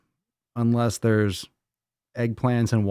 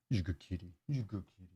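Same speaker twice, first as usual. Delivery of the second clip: soft praise to pet; 4 semitones lower, 14 dB quieter, much faster than usual. Real, not acted. Praising the cat.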